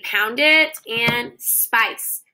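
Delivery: spice said very slowly; slow pounding. Shown as a demonstration. Teacher pounding a word with kiddos. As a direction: stretch spice out while pounding.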